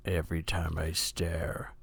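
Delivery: low, soft, fading to a slow whisper; Morgan Freeman impression. Friend does a mock narrator, sinking to a low whisper. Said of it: "Morgan Freeman impression".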